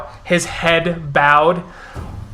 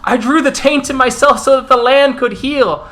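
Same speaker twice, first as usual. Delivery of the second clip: high-pitched, urgent protest; desperate defence, voice pitched up; pleading accused Gorel; 8 semitones higher, 5 dB louder than usual. Acted. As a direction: plead your innocence before a hostile crowd. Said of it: "As the Gorel goes".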